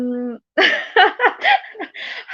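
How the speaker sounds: high-pitched peal of laughter; helpless high giggling; real laugh. Real, not acted. Amused reaction to a viewer.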